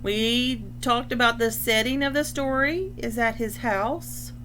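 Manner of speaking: ordinary delivery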